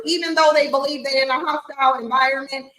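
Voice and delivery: usual speaking voice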